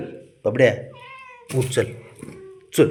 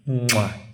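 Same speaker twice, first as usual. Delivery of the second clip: kiss; 2 semitones higher, much slower than usual. Real, not acted. Kissing the child.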